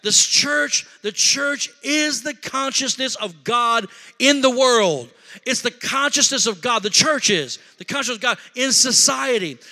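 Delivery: building to a loud, high shout; impassioned preaching. Acted. Preacher urging El Paso that we need the church, mounting impassioned preaching. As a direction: preach with mounting force, stretching the key phrases.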